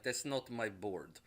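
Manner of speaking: put-on slow, low Russian accent. Whiplash rejecting the bird, slow Russian accent. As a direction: complain slowly in a Russian accent. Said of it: "and he's like".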